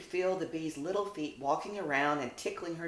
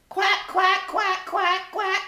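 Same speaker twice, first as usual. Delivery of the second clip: high loud quacking; duck quacks; 9 dB louder, slower than usual. Acted. Ducks greeting the puppies, loud quacking. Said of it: "quacks the ducks".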